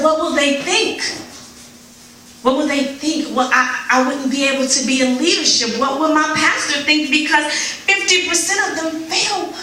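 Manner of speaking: high, worried questions, building up; anxious fretting. Retold or imitated. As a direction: fret about what others think. Retold anxious worrying to God.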